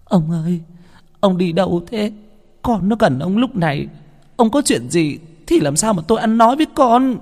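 high, distraught wife's plea; tearful wailing. Acted. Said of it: "vợ ông chạy ra ôm ông mà khóc".

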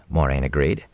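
plain reading voice

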